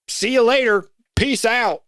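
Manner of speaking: a son's cheery Southern farewell; thick Southern accent. Retold or imitated. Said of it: "that thick Southern accent".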